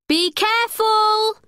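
high, slow called warning; worried friend calling down the rocks. A narrator's reading. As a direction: call after her friend as she climbs. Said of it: "called Natalie".

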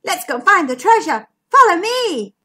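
loud, high rallying call; shouting. A narrator's reading. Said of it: "shouted McMeer".

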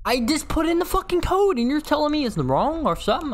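high-pitched exasperated complaint; frustrated outburst. Real, not acted. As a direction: protest at the game in disbelief. Frustrated at rejected code.

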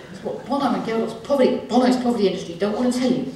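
ordinary talk, nothing marked

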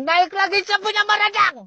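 high-pitched exasperated outburst; fuming. Acted. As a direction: let the anger boil over. Fuming about the dead chicken.

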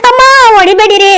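crying out and begging him to stop; pained pleading. Acted. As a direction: plead through the pain. Wife begs as she is hit. (ನೋವಿನಿಂದ ಗೋಗರೆಯುತ್ತಾ)

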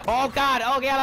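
startled, loud oh god; alarmed cry. Real, not acted. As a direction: cry out in alarm as you're hit. Getting killed in game.

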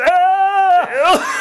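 high sharp cry; pained scream. Real, not acted. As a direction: scream slips out anyway. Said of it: "I'm trying not to".

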